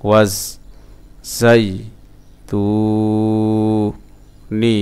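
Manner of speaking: ওয়াজ, জাই, তু, নি each separated; broken-up syllables. Shown as a demonstration. Teacher sounding out a word for learners, syllable by syllable. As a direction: pause after each, holding তু. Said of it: "ভাঙা ভাঙা উচ্চারণ".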